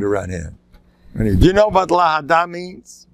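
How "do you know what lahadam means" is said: put-on raised, rabbinic Yiddish accent; Rav Hutner. Rabbi asking someone a question, heavy Yiddish accent. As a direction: ask it with a rubbed-in Yiddish accent. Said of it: "With an accent".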